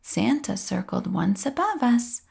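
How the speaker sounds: ordinary delivery, nothing marked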